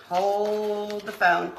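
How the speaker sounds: slow, drawn-out; lazy drawn-out phrase; slow drawl. Pretend. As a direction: stretch every word out. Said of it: "repeats the phrase twice".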